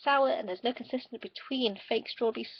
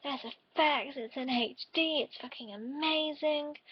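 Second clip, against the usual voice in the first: mock hype; 5 semitones higher, much slower than usual. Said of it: "it'll be like".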